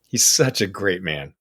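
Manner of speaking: ordinary delivery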